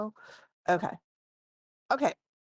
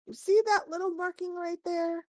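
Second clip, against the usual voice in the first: much higher, eager shopkeeper; 12 semitones higher than usual. Acted. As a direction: point it out like a proud expert. Shopkeeper points out the mark.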